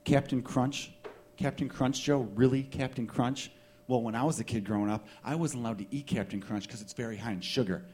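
soft, low know-it-all put-down; mock-superior buddy, quietly disdainful; smug, condescending. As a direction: look down on a friend's taste. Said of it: "real smug, real condescending".

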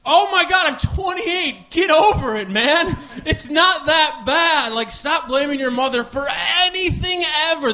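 high, loud pep talk to himself; scolding self-talk. Retold or imitated. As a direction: scold yourself like a tough coach. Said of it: "And I was thinking, like".

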